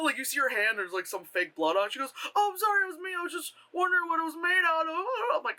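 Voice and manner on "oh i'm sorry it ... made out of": high, hurried mock apology; apologetic girl. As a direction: imitate a flustered girl apologizing. Acted girl apologizing for the poke.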